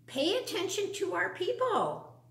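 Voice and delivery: plain reading voice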